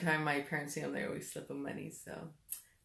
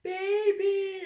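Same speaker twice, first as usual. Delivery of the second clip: imitating crying dad; 14 semitones higher, much slower than usual. Mother imitates tearful husband at school gate, mock sob. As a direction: drag it out like a tearful father. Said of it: "and he's crying".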